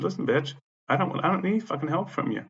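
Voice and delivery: high-pitched indignant outburst; retold angry rebuff. Tenant turning down the manager's help, indignant.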